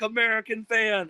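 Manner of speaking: high-pitched imitated band cheer; mock excited shout. Whole band greeting their American fan, high mock shout. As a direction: echo the band's excited holler.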